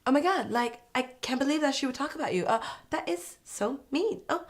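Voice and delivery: put-on high, quick girly gossip voice; hammed-up, high-pitched outrage; mock gossip girls. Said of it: "The Gossip Girls, emphasis on girls".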